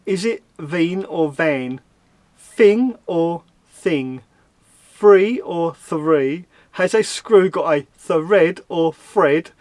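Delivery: slow, deliberate contrast: "veen or van", "thing or thing", "three or three"; mock pronunciation test. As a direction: read each "or" pair slowly, teasing the Cockney sounds. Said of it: "it is concerning the way I speak".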